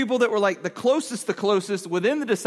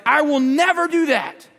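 loud, high-pitched insistence; raised-voice mock vow; emphatic promise; 6 semitones higher, 5 dB louder than usual. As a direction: swear it forcefully, acting dead serious. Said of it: "he's serious".

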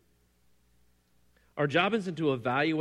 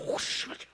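breathy whooshing sound effects; imitated sword swishes; 7 dB quieter than usual. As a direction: mimic blades cutting the air. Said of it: "they're like".

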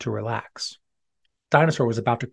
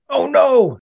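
surprised; 12 semitones higher, 6 dB louder than usual. Acted. Ball smashes dad's car.

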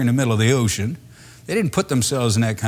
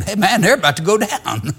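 higher, casual mock sailor voice; 8 semitones higher, 4 dB louder than usual. Preacher voicing a sailor watching other ships sink, lightly mocking. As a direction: imitate a sailor pointing at the other boats. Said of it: "looking out there at those other little ships saying".

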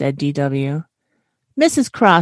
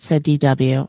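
usual speaking voice

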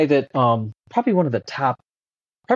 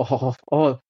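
wry dread. Retold or imitated. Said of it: "and I go".

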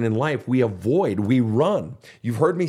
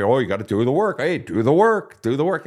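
mock cliche. Counselor mocking the empty advice, mock sing-song. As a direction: parrot the empty slogan. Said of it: "what the fuck does that mean?".

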